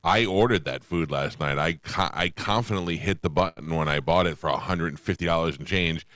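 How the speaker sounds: ordinary talk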